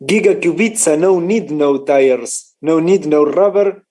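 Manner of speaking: slow, hammed-up Polish accent as the joking Kubica; mock Polish accent. Streamer joking about Kubica on his rims, mock Polish accent. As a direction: drag the words out in a fake Polish accent, stressing no and rubber. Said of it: "Your Polish accent sounds more like Russian".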